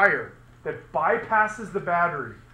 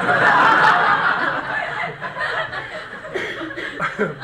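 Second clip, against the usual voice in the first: high breathless silent laugh; helpless wheezing laughter; 8 dB louder than usual. Real, not acted. After recording remark.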